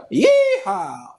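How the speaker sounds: loud, high Texan cowboy whoop; Texan accent. Shown as a demonstration. Capping the cowboy bit, loud Texan yeehaw. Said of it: "the Texan accent".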